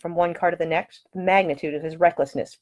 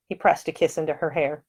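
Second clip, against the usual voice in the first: plain reading voice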